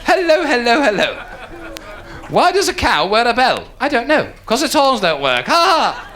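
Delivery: put-on old-time comic with a forced "ha ha"; corny club comic. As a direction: rattle out a corny stage gag. Same hack comic returns.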